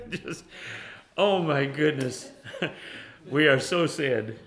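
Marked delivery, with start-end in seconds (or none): none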